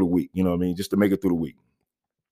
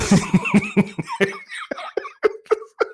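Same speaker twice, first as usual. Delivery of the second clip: high, real fit of laughter; laughing. Real, not acted. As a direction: laugh helplessly at your own story. Laughs at own story.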